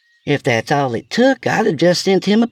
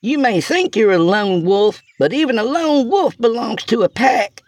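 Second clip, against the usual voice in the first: high, carrying speech to the cabin; shouting; 3 dB louder than usual. A narrator's reading.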